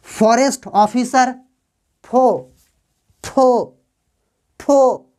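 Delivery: फॉरेस्ट ऑफिसर slowly, then फो repeated alone; slow teacher's model of फॉरेस्ट ऑफिसर and फो; pronunciation demo. Shown as a demonstration. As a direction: isolate फो as one drawn "pho", pausing between repeats. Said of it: "उच्चारण देखो".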